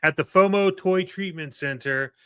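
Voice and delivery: over-the-top commercial reassurance; mock infomercial read. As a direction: deliver the hopeful turn like a TV ad.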